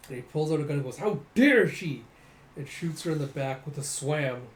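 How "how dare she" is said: loud, slow, indignant outburst; outraged shout. Acted. Villain raging before shooting her in the back, loud and slow. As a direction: spit it out in slow fury.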